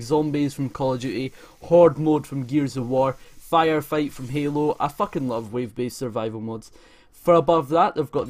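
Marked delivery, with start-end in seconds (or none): none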